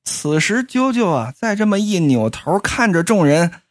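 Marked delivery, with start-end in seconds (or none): none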